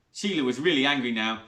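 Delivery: plain reading voice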